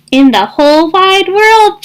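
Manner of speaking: high, mildly loud shout. Acted. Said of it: "shouted Thistle".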